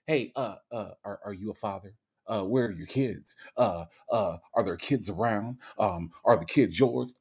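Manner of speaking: low, muttered mock interrogation; mimicking nosy questioners. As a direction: play a suspicious clerk quizzing him in an undertone. Imitating nosy staff questioning him.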